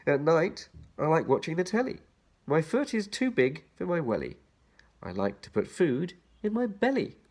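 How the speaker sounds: put-on bouncy children's rhyme read. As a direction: lilt each line to its rhyme. Reads the rhyme aloud.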